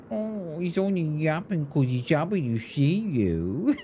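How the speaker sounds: cooing, doting dog-owner voice; baby-talk over an adored dog, mockingly; mock pet voice. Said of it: "Do you like my pet voice".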